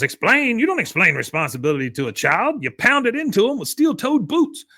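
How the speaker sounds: put-on high, indignant old man; harsh outburst. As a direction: scoff and bark like a drill sergeant.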